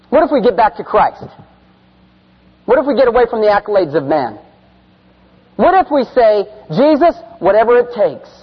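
loud, high preaching build; fiery preaching. Acted. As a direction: preach it hard to the crowd. Preaching at full force.

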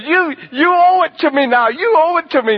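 put-on high, loud shout after running kids; calling after them. Tim calling after the fleeing kids, loud high shout. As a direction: yell after them as they run.

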